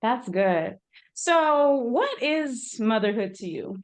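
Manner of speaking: ordinary talk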